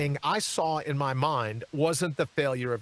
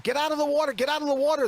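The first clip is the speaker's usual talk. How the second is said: high, loud yell to get out; retold scream; 9 semitones higher, 4 dB louder, faster than usual. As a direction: shout the warning from the bank. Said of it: "one of the other guys screamed".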